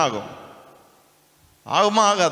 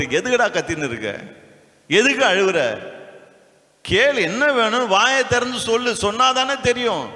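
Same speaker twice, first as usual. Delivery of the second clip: scolding parent; 7 semitones higher than usual. Retold or imitated. Parent voiced scolding child.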